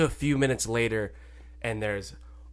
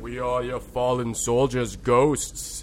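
slow, louder ghostly voice; 3 semitones lower, 5 dB louder, slower than usual. Acted. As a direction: loom over him as a ghost. Ghosts appear in ritual.

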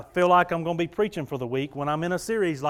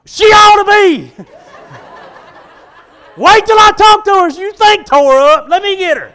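high, loud shouting through laughs; laughing comic fury; mock rage with laughter; 13 semitones higher, 17 dB louder than usual. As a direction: ham up wanting to go after her. Joking he wants revenge.

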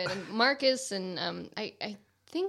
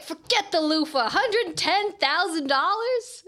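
higher, louder, slow disbelief; incredulous quote; 8 semitones higher, 7 dB louder, slower than usual. Retold or imitated. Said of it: "it's like".

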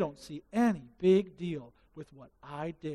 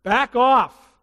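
defensive snap; 4 semitones higher, 9 dB louder than usual. Acted. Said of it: "Force fields go up".

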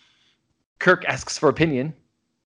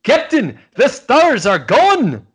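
put-on loud, high, botched Russian accent sliding toward Scottish; horrible Russian accent; 11 semitones higher, 8 dB louder, much slower than usual. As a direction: cry it out slowly in a bad Russian accent. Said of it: "That was a horrible Russian".

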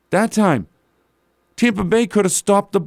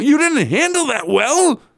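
much higher, mocking disbelief; incredulous echo; 9 semitones higher than usual. Real, not acted. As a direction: throw his words back at him, stunned. Mocking coach's postgame quote.

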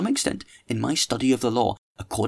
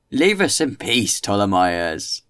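loud, slow, drawn-out protest; loud, stretched complaint from the group; rebellious protest; 6 dB louder, much slower than usual. Acted. Rebellious group heckles the speaker.

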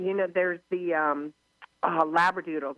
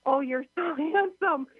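put-on high, tender baby talk to a dog; soothing pet talk; 10 semitones higher than usual. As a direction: console the poor dog sweetly. Comforting the mortified dog.

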